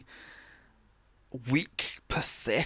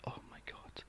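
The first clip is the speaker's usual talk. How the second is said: whispered aside; 21 dB quieter than usual. Real, not acted. Exasperated aside to friend.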